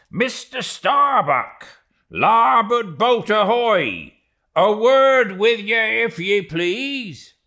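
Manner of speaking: high, loud hail; cheery loud ahoy to the mate; hailing across the water. Acted. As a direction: call out to the other boat. Hailing the other boat.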